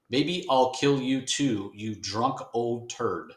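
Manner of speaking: slow, drawn-out mock menace; slow hammed-up bad-guy threat; cheesy villain threat. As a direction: ham up a nineties villain line slowly. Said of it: "Frank Miller at his".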